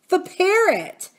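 aunt voice. A narrator's reading. Aunt Polly explaining.

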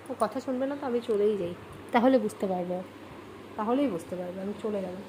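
low, soft pretend-offended murmur; mock sulky aside. Real, not acted. Mother pretends to leave her stubborn child, soft mock sulk. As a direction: mutter it as if giving up.

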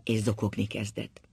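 ordinary delivery

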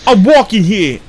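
put-on high, loud Brooklyn accent bark. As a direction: mimic a Brooklyn tough guy accent. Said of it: "a very stereotypical Brooklyn accent".

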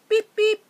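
high, loud beeping like a toy horn; car horn beep. Pretend. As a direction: honk at them like a little truck. Toy truck honks hello.

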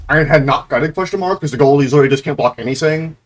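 ordinary delivery

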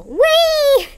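high, loud whee; joyful squeal; gleeful whee. Real, not acted. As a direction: ride the swing with glee. Swinging high.

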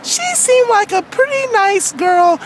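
character storytelling voice; aggrieved Wendy. Acted. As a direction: recount it as a wronged cartoon girl. Wendy tells of the trip offer.

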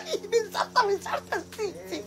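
high whimpering cry that fades away; mock panicked cry. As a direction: squeal it high, then let it die out. (chillido agudo fingido que se apaga)

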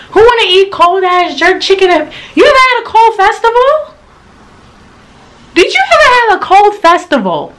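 high indignant complaint; outraged rhetorical. Real, not acted.

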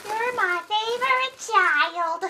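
squeaky helium-pitched voice. Real, not acted. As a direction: chipmunk voice from helium. Helium voice after inhaling.

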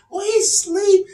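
high-pitched mimicking falsetto; sing-song high put-on voice; mock naive parent. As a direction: coo it high, mocking a clueless parent.

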